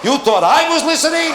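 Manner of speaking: high, slow shouting accusation; mock marital fight. Wife yelling at husband after the directions, high mock shout. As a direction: hurl it at the husband. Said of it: "the same fight".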